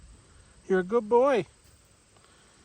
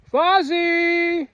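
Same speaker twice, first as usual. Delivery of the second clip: loud, high call; calling the cat; 12 semitones higher, 8 dB louder than usual. Real, not acted. Owner calling lost Fozzie, loud and high. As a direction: shout the name high so he hears.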